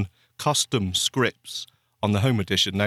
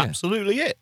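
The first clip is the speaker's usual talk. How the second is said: normal talking voice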